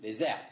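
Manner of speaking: "Les herbes" linked with a Z sound; linking Z in "Les herbes"; liaison. Shown as a demonstration. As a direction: join "Les herbes" as "lé-zerb". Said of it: "il va faire la liaison".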